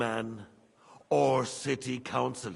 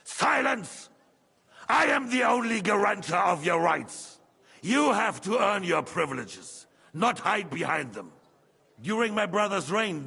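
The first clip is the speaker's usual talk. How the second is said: raised, higher furious royal voice; furious shout; 6 semitones higher, 6 dB louder than usual. Acted. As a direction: roar down the protesting councillor. King furious at the council.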